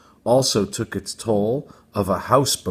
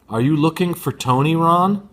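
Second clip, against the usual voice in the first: calling out; 7 semitones higher, 5 dB louder than usual. Acted. Neighbour mother calling from door.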